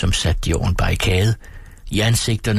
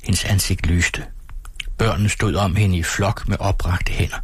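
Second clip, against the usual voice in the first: ordinary delivery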